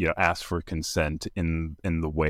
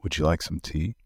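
slightly lowered, a hint of a deep voice; 3 semitones lower than usual. Shown as a demonstration. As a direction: drop the voice a little to offer tea. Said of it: "You can say in a deep voice".

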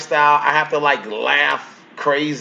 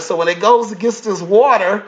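normal talking voice

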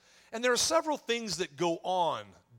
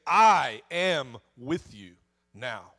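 slow declaration; 5 semitones lower, 7 dB louder, much slower than usual. Acted. Jesus declaring at ceremony.

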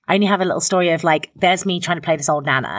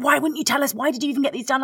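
retold livid scolding; 9 semitones higher than usual. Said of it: "they were livid".

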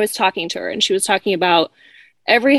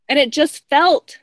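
normal talking voice

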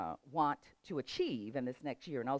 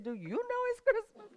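high-pitched comeback, less shouted; retold retort. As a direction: toss the scolding question right back, high-pitched. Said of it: "I said".

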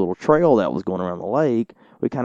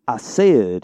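put-on Cajun accent; 4 dB louder than usual. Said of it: "a very strange accent".

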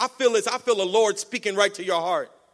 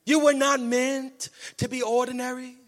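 Joel Osteen; 4 dB quieter than usual. Acted. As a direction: imitate a televangelist, stretching each phrase out. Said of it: "Joel Osteen, my boy".

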